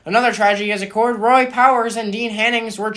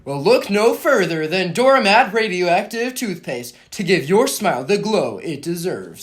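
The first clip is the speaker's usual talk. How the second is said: hammed-up announcer asking the listener; ad pitch; 4 semitones lower than usual. Announcer pitching toothpaste to listeners, hammy ad read.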